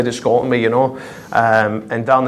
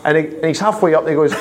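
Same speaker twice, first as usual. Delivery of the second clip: ordinary talk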